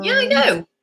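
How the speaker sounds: high cooing reply to a pet. Real, not acted. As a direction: squeak it back at the dog. Answering the dog.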